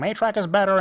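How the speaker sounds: high, loud nasal nerd squeal; annoying nerd voice. Retold or imitated. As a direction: whine it like an eager nerd. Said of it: "in an annoying nerd voice like I'm doing".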